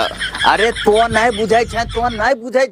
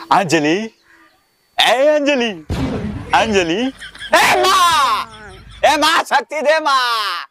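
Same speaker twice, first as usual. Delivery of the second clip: Sunil film impression; slower than usual. Fan trying out a film dialogue, hammed-up anguished hero. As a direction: overplay a filmi hero calling out, drawn-out. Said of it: "ई सुनील से ठीक dialogue छिके".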